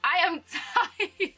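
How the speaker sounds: laughing through "I am tired", slow. Real, not acted. Vlogger laughing at her own accent slip. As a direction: giggle at your own slip. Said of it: "my accent just came out".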